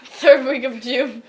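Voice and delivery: mock expert. Real, not acted. Mock-serious echo of friend.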